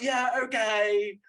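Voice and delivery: high, loud, drawn-out sarcastic okay; exasperated mockery. Viewer answering forced R rating, loud mock exasperation. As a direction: humour them loudly, exasperated. Mocking the film's edgy ending.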